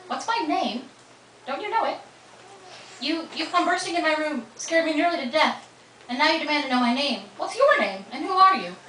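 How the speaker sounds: she's had enough, push back at him; indignant disbelief. Acted. Tessa confronting the intruder in her room, indignant. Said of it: "Tessa looked at him in disbelief".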